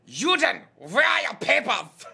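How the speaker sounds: loud, high, slow barking German officer; mock German officer. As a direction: demand papers like a movie villain. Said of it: "the most German I know".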